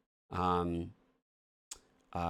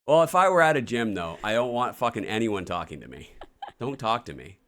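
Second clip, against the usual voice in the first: loud, high, laughing mock rant; mock indignation; 4 semitones higher, 8 dB louder than usual. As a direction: push it out loudly, half laughing. Mock tirade about being approached at gym.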